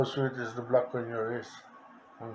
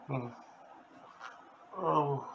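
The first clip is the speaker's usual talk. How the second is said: ordinary delivery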